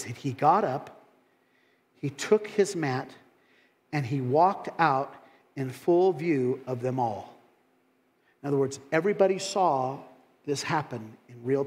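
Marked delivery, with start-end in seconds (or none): 0.0-7.3 dramatic scripture reading. Acted.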